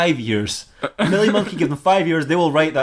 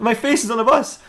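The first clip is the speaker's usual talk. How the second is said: high-pitched comic disbelief; acted bewildered outburst, pitched way up; incredulous; 9 semitones higher than usual. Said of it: "And he's like".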